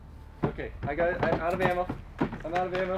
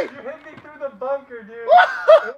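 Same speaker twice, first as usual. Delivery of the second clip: laughing disbelief; 8 semitones higher, 13 dB louder, slower than usual. Real, not acted. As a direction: voice cracking up high. Hit through the bunker hole.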